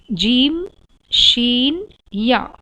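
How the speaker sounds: "जीम", "शीन", "या" each said firmly from the middle of the tongue; letters demonstrated. Shown as a demonstration. Teacher sounding out the three letters for the class. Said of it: "तीनों हुरूफ के मखरज एक जैसे हैं".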